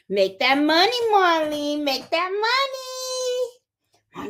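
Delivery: high singsong cheer; playful, lilting hype; cheering someone on. Real, not acted. Cheers on a viewer.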